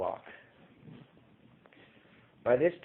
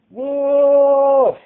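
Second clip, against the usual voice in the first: very high, very loud screech; screeched wail; 16 dB louder than usual. A narrator's reading. Townsperson spotting the wolf, terrified screech. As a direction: scream it in terror. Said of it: "A wail screeched out of the crowd".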